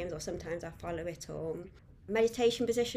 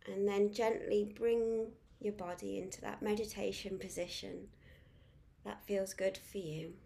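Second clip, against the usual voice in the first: guided meditation; 9 dB quieter than usual. Acted. Guide inviting listeners to focus on the breath, soft meditation voice. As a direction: ease them gently into calm, letting each phrase settle.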